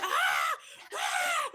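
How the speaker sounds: sharp high yelps of pain; pained screams. Acted. Villain screaming high as Viper jumps down on him. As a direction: scream as the pepper spray lands.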